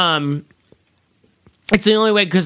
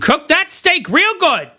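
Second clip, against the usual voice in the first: mock high voice; 10 semitones higher than usual. Mock voice bit.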